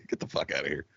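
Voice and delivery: low, quiet mock brush-off; mock dismissal. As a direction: throw him out under your breath. Repeats the mock ejection.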